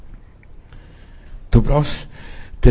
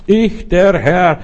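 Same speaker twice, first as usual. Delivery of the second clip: higher, slow and solemn, drawn out; slow God voice; 6 semitones higher, much slower than usual. Acted. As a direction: proclaim as the Lord, slowly. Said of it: "sagt hier mein Gott".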